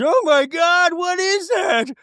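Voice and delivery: high, frantic, shrieking mock panic; mock frantic Meredith. Meredith spotting something in the woods, hammed-up panic. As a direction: shriek it out like you're terrified in the woods. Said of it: "And Meredith goes".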